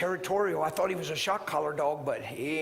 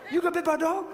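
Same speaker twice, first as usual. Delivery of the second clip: high-pitched Southern man impression; Southern voice pushed up high; imitated Southern accent; 10 semitones higher, 5 dB louder, faster than usual. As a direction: mimic the Southern fella's surprised question. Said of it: "that's just how he talked".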